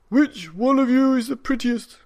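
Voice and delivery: put-on high, loud calling voice of the old man; old man calling cats, high and loud.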